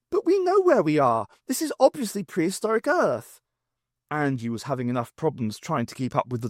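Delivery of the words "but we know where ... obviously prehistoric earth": much higher voice, protesting; higher protesting reply; indignant. Acted. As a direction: push back breathlessly. Angie arguing while running.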